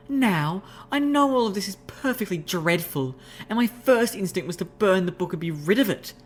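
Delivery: high refined lady's voice, explaining; refined unicorn explaining. Acted. Rarity explaining soul jars, high refined voice.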